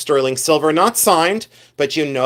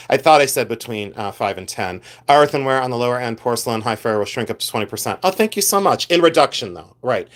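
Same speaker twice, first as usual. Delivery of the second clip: normal talking voice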